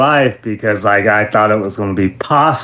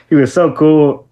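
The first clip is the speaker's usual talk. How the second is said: usual speaking voice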